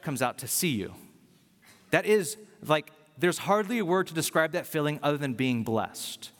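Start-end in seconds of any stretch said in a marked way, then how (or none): none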